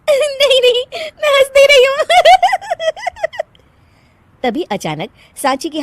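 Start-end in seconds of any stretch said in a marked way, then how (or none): 0.0-2.0 high-pitched protest through giggles; laughing denial. Acted. Daughter-in-law denies it before the guests, laughing uncontrollably. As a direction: insist you're not laughing while laughing. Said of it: "हंस क्यों रही हो".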